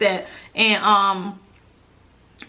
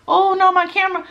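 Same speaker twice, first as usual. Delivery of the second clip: louder, higher yelp of alarm; alarmed surprise; 9 semitones higher, 4 dB louder than usual. Real, not acted. Vlogger to viewers as the camera dies, alarmed. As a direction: blurt it as the camera fails.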